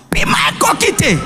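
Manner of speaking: very high shouted exclamation; mock fiery outburst; pulpit shout. Shown as a demonstration. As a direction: blurt it like a sermon cry. Preacher-style outburst, contrasted.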